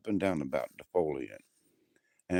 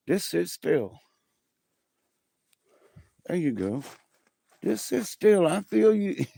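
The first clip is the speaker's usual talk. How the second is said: much higher, sing-song coaxing to a dog; pet talk; 11 semitones higher than usual. Real, not acted. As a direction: coax a shivering dog to sit still. Coaxing shivering dog to sit.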